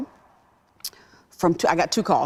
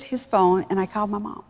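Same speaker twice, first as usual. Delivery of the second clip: ordinary delivery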